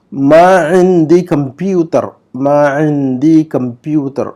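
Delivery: slow, syllable by syllable Arabic; slow model reading. Shown as a demonstration. Teacher reads the Arabic example slowly for students. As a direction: read it out slowly for students to copy. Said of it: "number نو کا جملہ".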